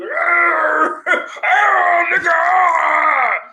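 loud, high, drawn-out growling holler; hammed-up wake-up yell; mock roar. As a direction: act out roaring someone awake. Acts out rude midnight wake-up.

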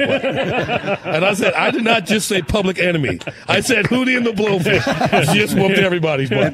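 high, loud, laughing through the words; laughing while talking. Real, not acted. Cracking up telling the owner about [name]. Laughing hard retelling bar quote.

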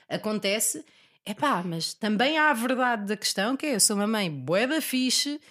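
ordinary talk, nothing marked

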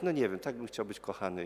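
low, soft, imitated noncommittal answer; unsure reply. Visitor asked about serving in church, low and unsure. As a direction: answer small and aimless, as the visitor.

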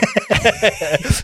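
bright burst of laughter; high laugh. Real, not acted. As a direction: laugh freely. Laughing at the joke.

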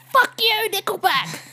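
high, hammed-up yell at the band; mock angry fan. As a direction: spit it out like an outraged metalhead. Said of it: "these are the fans that are like".

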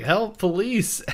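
high-pitched imitation of calling out; hammed-up high plea; mock cry for help. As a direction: call for help in play-acted alarm. Acting pig fleeing, calling police.

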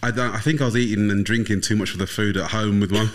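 normal talking voice

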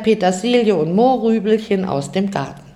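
plain reading voice

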